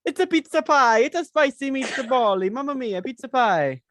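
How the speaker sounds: put-on over-the-top Italian pizza accent; mock Italian accent. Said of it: "they probably sound a little bit like".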